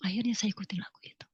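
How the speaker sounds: fast, whispered confession; quick whisper. Real, not acted. Admitting to the congregation she gave in, whispered fast. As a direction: rush it out under your breath.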